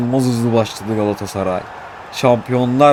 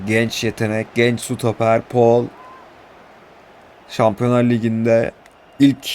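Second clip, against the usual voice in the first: usual speaking voice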